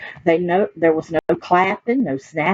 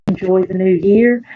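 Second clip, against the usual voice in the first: ordinary delivery, nothing marked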